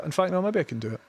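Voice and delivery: ordinary talk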